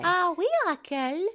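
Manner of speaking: put-on high lilting French accent. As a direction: answer like a giggly French maid. Skit, voicing Babette's reply.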